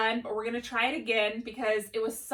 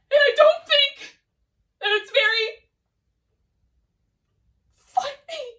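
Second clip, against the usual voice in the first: much higher and louder squeaky cartoon voice; silly high voice; 11 semitones higher, 8 dB louder than usual. Shown as a demonstration. Teacher's first Chocolate Bunny example, much higher and louder. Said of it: "two examples of Chocolate Bunny using different voices".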